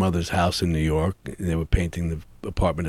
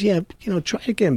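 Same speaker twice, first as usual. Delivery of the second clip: lightly voiced director, pitched up; a hint of the director, higher and brisk; quoting the director; 13 semitones higher, faster than usual. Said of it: "He goes".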